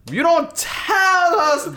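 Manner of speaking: high, loud, hammed-up Jamaican woman; Jamaican accent. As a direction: shout it as an outraged Jamaican woman, drawn out. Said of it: "And then she Jamaican".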